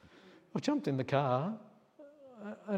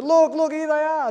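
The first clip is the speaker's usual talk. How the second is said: much higher, louder yell to his sister; 14 dB louder than usual. Retold or imitated. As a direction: call out across the field in joy. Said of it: "he yelled to his sister".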